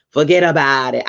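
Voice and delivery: joking fake Italian accent; worst Italian accent. Host showing her terrible accent, mock Italian. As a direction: throw out a bad Italian-accent catchphrase. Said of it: "It's, like, the worst Italian accent".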